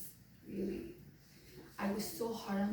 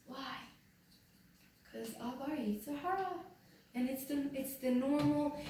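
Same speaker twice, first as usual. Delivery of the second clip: ordinary talk, nothing marked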